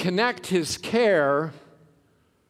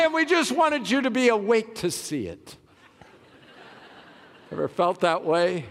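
ordinary delivery

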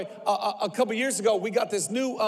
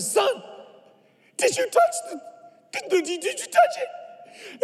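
mock-incredulous dad, voice pitched way up; high-pitched questioning; 13 semitones higher than usual. Dad questioning his blistered son, high-pitched disbelief.

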